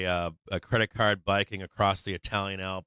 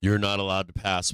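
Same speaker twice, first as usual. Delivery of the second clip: gatekeeper bear. Retold or imitated. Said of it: "He's just up there with big boulders like".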